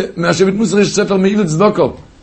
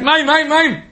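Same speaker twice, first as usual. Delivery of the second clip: pitched-up shout of mim mim; retold shout; 9 semitones higher than usual. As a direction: call out high as the minister cried for water. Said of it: "אז הוא צעק".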